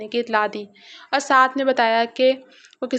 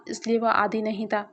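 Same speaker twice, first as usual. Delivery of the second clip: ordinary delivery, nothing marked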